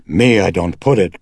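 lightly voiced defensive growl. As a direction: push back on the accusation gruffly. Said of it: "growled Willie defensively".

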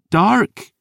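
bitter outcry; crying out. Acted. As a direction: cry out in disbelief. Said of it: "Isla cried".

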